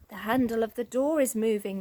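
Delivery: plain reading voice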